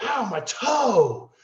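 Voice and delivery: high, slow, whiny yelp of pain; mock whiny yelp. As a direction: ham up a sorry, hurt little cry. Mock complaint of stepped toe.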